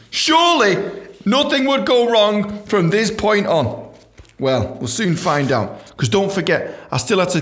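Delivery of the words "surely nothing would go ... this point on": high, slow mock-naive confidence; sarcastic optimism; ironic hope. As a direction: tease what's coming. Ironic foreshadowing.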